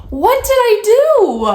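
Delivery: high, loud, drawn-out bewildered plea; exasperated protest. Retold or imitated. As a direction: cry out in exasperated disbelief. Exasperated retold reaction to snub.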